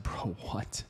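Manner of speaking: breathless, voiceless disbelief; stunned whisper. Retold or imitated. Friend hearing the story, stunned whisper. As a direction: mouth it in shock.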